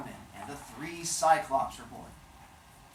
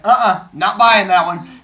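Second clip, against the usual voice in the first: loud, higher mock objection; skeptical refusal; 5 semitones higher, 13 dB louder than usual. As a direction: cut off the gushing, unconvinced. Father Sky rejects her gushing.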